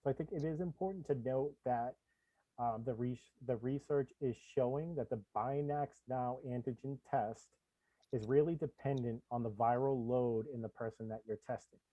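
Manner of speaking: muffled, like through a wall. Real, not acted. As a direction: voice muffled by a bad mic. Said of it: "You sound muffled".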